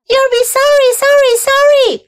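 loud, very high goose warning; calling goose. A narrator's reading. Goose warns the departing pig, calling out. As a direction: holler a high, insistent warning. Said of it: "called the goose".

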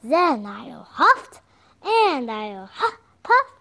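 slow, loud wolf threat rising high; growling wolf. Acted. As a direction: huff and puff like a hammy werewolf. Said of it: "growled the wolf".

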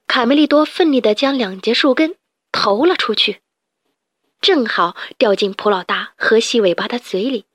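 ordinary delivery, nothing marked

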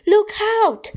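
high, loud alarm call; urgent cry, pitch leaping up; warning shout. A narrator's reading. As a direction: shout to warn of danger. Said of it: "shouted Henry".

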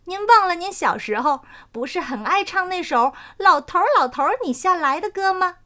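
a pleased flower spirit teasing gently; dandelion spirit. Acted.

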